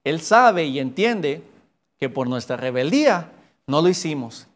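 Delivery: ordinary talk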